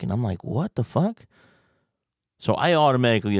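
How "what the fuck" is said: high, incredulous "what the fuck"; stunned disbelief, pitched up; a mock-startled double take; baffled. Baffled inner reaction.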